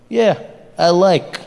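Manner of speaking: lightly voiced Russian accent; short reply in a mock Russian accent. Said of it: "the Russians were just like".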